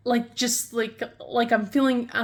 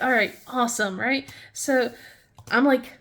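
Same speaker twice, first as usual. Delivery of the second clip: normal talking voice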